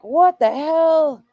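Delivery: high, slow, drawn-out exclamation; mother reliving the moment, high and slow; shocked outburst. Retold or imitated. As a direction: stretch it out in disbelief.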